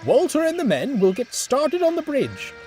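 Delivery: rally the crew; commanding manager. Acted. Manager gives orders.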